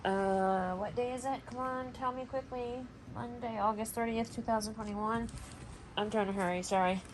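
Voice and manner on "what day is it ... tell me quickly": normal talking voice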